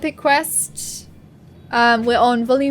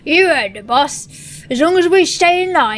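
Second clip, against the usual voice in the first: orc lackey voice; 3 semitones higher, 5 dB louder than usual. Acted.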